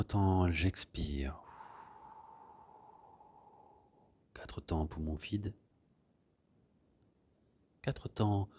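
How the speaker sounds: gentle meditation voice leading breathing; guided meditation. Acted. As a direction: ease the class into stillness. Leads a guided relaxation.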